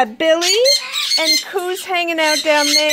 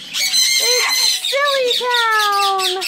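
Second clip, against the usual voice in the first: slow, higher, drawn-out; playful sing-song; 6 semitones higher, much slower than usual. Real, not acted. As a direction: stretch it out like a silly tune. Playful comment on the noisy birds.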